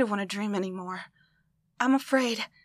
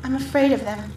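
ordinary talk, nothing marked

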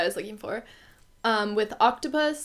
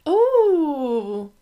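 excited ooh; 5 semitones higher, 8 dB louder than usual. Real, not acted. Reacts to a nine rating.

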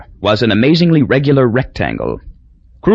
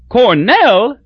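Cornell rising high and louder in glad surprise; glad cry; 12 semitones higher, 3 dB louder than usual. A narrator's reading. Crosby delighted to hear Newt went to Cornell, glad high cry. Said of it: "cried Crosby gladly".

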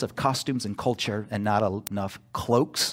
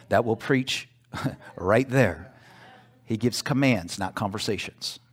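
normal talking voice

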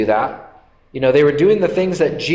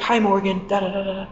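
put-on high-pitched, softer kid chatter; excited child; 7 semitones higher, 4 dB quieter than usual. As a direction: babble a greeting like a thrilled kid. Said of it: "he's really excited".

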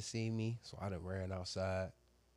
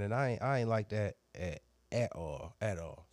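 ordinary talk

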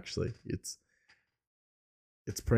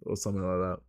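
ordinary talk, nothing marked

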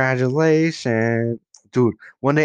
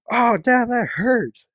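much higher, hammed-up pained whine; mock yelp of pain; 12 semitones higher, slower than usual. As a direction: squeal it like the BB just hit you. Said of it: "acted reaction to getting shot".

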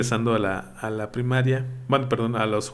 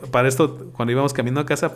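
ordinary delivery

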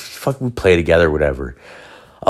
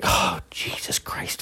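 slow frantic whisper; panicked whisper; 10 dB quieter, slower than usual. Retold or imitated. Panicked thought, caught.

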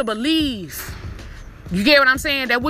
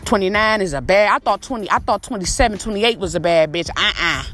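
low swagger boast; 6 semitones lower than usual. Pretend. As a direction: drag it low and cocky.

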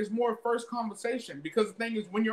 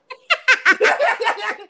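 high burst of laughter; loud laugh; 14 semitones higher, 12 dB louder than usual. Real, not acted. Big laugh at a joke.

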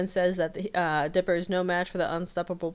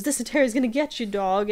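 fast, higher and louder taunt; hyped chase; 5 semitones higher, 5 dB louder than usual. Retold or imitated. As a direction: rush it out while chasing him off. Voicing Seuss chasing a member.

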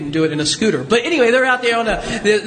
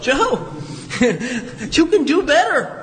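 higher, slow, chuckling at your own bad shot; laughing self-scolding; 5 semitones higher, much slower than usual. After bad golf shots, preacher chides himself with a laugh. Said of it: "And I would say to myself".

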